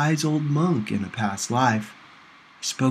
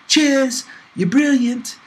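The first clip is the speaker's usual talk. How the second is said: put-on cheery high British accent, much louder; bright, loud British thank-you in a high voice; 13 semitones higher, 5 dB louder, slower than usual. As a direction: chirp it like a charming Englishwoman. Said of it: "in her charming British accent".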